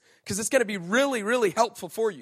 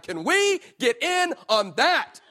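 eager angels; 6 semitones higher, 4 dB louder, slower than usual. Retold or imitated. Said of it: "being like".

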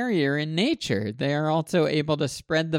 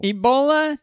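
loud high exclaim; 11 semitones higher, 7 dB louder than usual. Pretend. Joking exclamation.

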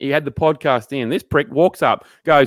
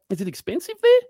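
lightly mimicked small talk, higher pitch; nosy coworker; 9 semitones higher than usual. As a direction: imitate a coworker's idle question. Nosy coworker's question retold.

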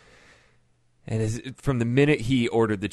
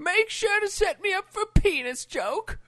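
put-on very high, slow old lady voice; hammed-up old dear; mock old woman. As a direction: croon it like a cheeky granny.

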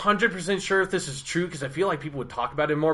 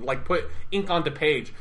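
ordinary talk

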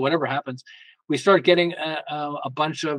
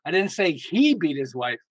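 "he" stretched and emphasized, slower delivery; stress on HE. Shown as a demonstration. Showing how one sentence changes meaning, slow stress on HE. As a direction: punch the word he. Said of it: "all the different ways it could be interpreted".

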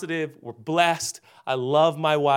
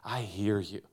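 tender reassurance; 4 semitones lower, 5 dB quieter than usual. Retold or imitated. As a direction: soothe her gently, then sneak in the pitch. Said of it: "you put your arms around her and you say".

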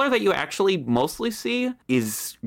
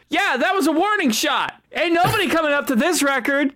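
boastful rival; 7 semitones higher than usual. Acted. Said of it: "you just hear him go".

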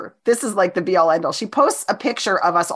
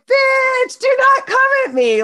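very high, loud mock yell; retold shout; 4 dB louder than usual. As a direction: snap back at her in a high shout. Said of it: "And I was like".

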